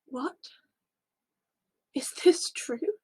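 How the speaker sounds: slow, breathless whisper of disbelief; stunned whisper. Acted. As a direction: barely dare to ask it.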